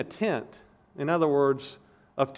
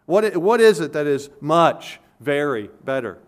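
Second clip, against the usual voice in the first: louder, hitting "much" and "very" in "much, very better"; louder, pressing on "much" and "very"; stress on much and very; 6 dB louder than usual. Shown as a demonstration. Preacher pressing the congregation on the point, stressing "much" and "very". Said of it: "for that is much, very better".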